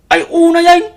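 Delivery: a loud, disbelieving outburst over the caption; shocked outcry. Acted. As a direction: let the outrage burst out. Reacts to the Facebook caption.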